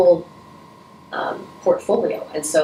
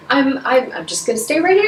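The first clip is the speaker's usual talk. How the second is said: hesitant, much higher retold voice of herself; nervous reply; 9 semitones higher, 4 dB louder than usual. Visitor hanging back from the operating table, timid high reply.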